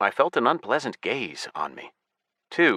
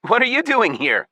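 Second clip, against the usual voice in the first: fake cheerful; 4 dB louder than usual. Hero plays cheerful innocent.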